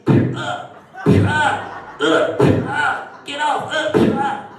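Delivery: high grunting fight noises; play-fight grunts for toy figures; action figure fight noises. Shown as a demonstration. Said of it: "It was something like this".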